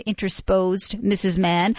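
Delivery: plain reading voice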